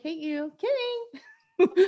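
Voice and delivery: a playful squeal on kidding; high squeaky kidding. Retold or imitated. Mother squeals back at bedtime to daughter, high and playful. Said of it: "And I'm like".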